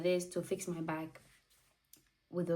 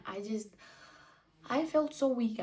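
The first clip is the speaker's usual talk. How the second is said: ordinary delivery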